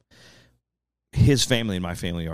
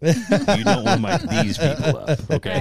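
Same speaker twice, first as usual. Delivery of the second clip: laughing; 7 semitones higher, 5 dB louder than usual. Retold or imitated. Mock warning, laughing through it.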